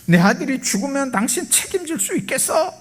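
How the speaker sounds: angry challenge. Retold or imitated. Father demanding the doctor take responsibility, angry challenge. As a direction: challenge them like an outraged father. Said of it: "의사의 속을 막 뒤집어 놓습니다".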